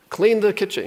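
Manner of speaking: high snappy chore command; lightly imitating a nagging order; a parent ordering the kids to clean up, high and sharp; mock order. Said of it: "instead of, like".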